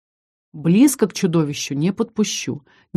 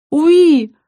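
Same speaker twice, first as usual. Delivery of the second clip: high, loud happy dragon squeal; baby dragon squeal; 10 semitones higher, 7 dB louder than usual. Acted. Little dragon Haven squeals eagerly at the heroine. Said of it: "сказал Хейвен".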